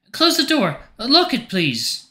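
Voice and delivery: very high-pitched character voice, instructing; Grace in the bath. A narrator's reading. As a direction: instruct in a high voice, easing down on please. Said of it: "Grace's voice comes from somewhere within the fog".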